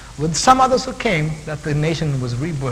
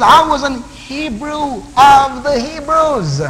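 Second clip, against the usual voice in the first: loud, high, drawn-out declaration; proud proclamation; 8 semitones higher, 7 dB louder, slower than usual. Acted. Preacher voicing Paul's boast to the congregation, proud proclamation. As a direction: proclaim it slowly and forcefully. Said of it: "He says".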